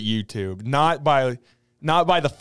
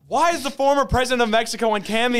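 high-pitched, baffled question; incredulous outburst; 9 semitones higher than usual. Real, not acted. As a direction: burst out in disbelief, pitch way up. Incredulous outburst at absurd Cameo listing.

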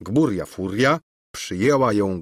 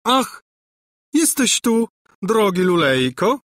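high, eager cry of greeting; gushing high-pitched welcome; exclaiming; 12 semitones higher, 5 dB louder than usual. A narrator's reading. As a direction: exclaim with loud, high delight. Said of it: "wykrzyknęła Gburja Furia".

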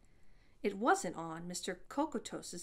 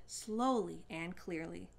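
ordinary delivery

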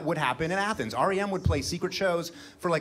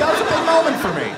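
laughing; 14 semitones higher, 10 dB louder, faster than usual. Real, not acted. Laughing, loud, fast.

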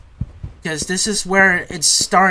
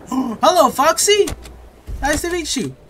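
gasp, then a much higher nervous hello; startled greeting; 12 semitones higher, 4 dB louder than usual. Real, not acted. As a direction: greet the monster with a panicked squeak. Startled by Foxy on camera.

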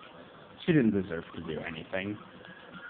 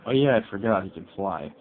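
ordinary talk, nothing marked

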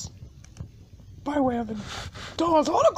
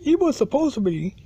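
ordinary delivery

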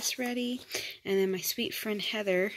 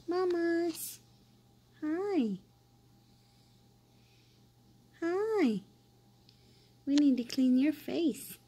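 higher, sing-song cooing at a newborn; baby talk; 7 semitones higher, slower than usual. Acted. Mom cooing at her newborn daughter, sing-song baby talk. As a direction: coo sweetly over the baby.

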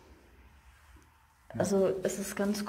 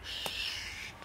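shushing; 10 dB quieter than usual. Real, not acted.

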